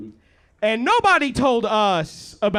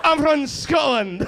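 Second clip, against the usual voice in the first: hammed-up Scottish accent, pitched up; 6 semitones higher, slower than usual. Comedian mimicking the scary audience member from Scotland, mock Scottish accent. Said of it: "That's a good accent".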